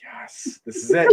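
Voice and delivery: starts breathy then jumps way up; excited cheer. Retold or imitated. Recalling the fight in the theater, rising thrilled cheer. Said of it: "I was like".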